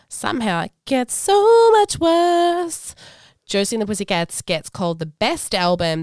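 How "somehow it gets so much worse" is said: mock dismay. Reading the review in mock dismay.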